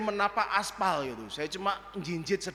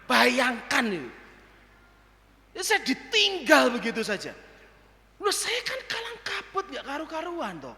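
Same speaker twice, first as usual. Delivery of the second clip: ordinary talk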